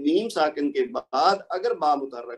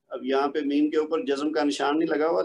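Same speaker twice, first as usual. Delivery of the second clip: normal talking voice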